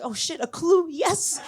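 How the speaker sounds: high-pitched mock excitement; excited discovery. Comedian retelling relief at getting a clue, excited. Excited inner reaction to a hint.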